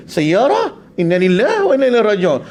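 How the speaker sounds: high, bold and sure of himself; confidently wrong pilgrim. Retold or imitated. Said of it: "confident dia".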